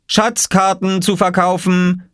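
high, slow street-seller call; calling out for sale. A narrator's reading. Boy hawks treasure maps in the garden, high drawn-out call. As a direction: sing out your wares like a young vendor. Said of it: "rief Oskar".

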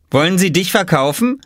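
mock the little brother; Robert teasing. A narrator's reading. Robert teasing Oskar about being for sale. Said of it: "fragte Robert".

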